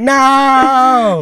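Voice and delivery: high, drawn-out mock yell; imitated long-distance no. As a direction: holler a refusal across the yard. Imitates yelled refusal from dock.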